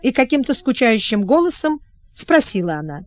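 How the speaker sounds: ordinary delivery, nothing marked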